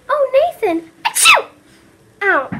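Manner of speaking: high sneezing showgirl; Adelaide impression. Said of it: "my best Adeline impersonation".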